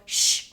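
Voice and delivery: short, forceful shh; loud shush. Acted. Teacher about to hush the boy, sharp shushing. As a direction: cut the noise with one shh.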